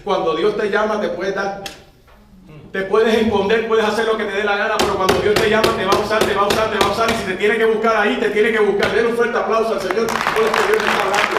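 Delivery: loud, driving sermon climax; preaching at full force. Acted. Pastor urges congregation to applaud, loud preaching. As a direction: hammer it home to the church.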